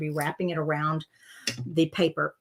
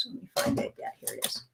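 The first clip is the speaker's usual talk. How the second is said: muttered to self; 4 semitones higher, 10 dB quieter, faster than usual. Real, not acted. Muttering while searching for supplies.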